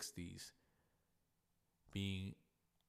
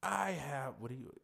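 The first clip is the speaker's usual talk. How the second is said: frustrated husband; 11 semitones higher than usual. Retold or imitated. Husband complaining to front desk, high indignant frustration. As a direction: vent like a man late for dinner reservations. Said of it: "he starts going off".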